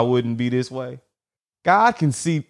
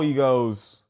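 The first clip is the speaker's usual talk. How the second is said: usual speaking voice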